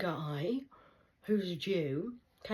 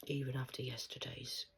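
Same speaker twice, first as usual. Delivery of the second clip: softer, lower conspiratorial whisper; 5 semitones lower, 7 dB quieter than usual. Real, not acted. Telling viewers about naughty Uncle Louis, whispering. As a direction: whisper it like gossip.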